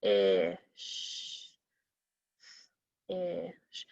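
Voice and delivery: "Ish" with a held short i, then a separate sh; sounds drawn out. Shown as a demonstration. Teacher asking for the vowel sound, drawn out. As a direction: stretch "Ish" softly, sound by sound. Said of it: "Which one of those is a vowel sound?".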